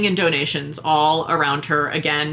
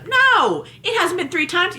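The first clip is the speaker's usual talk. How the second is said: much higher, quick mock retort; mock snapping volunteer; 13 semitones higher than usual. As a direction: fire back in a squeaky protest. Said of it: "she came at me quick".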